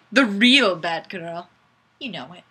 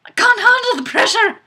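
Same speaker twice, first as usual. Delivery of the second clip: much higher and louder, hammed-up despair; mock panic; 12 semitones higher, 6 dB louder, faster than usual. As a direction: wail it like a comic meltdown. Jokes about failing the chant.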